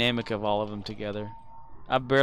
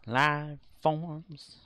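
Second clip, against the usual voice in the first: villain voice; 6 dB quieter, slower than usual. Retold or imitated. Quoting a game villain.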